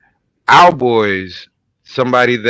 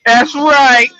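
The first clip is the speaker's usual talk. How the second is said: hype intro; 10 semitones higher, 8 dB louder than usual. Acted. Hype podcast opener over music.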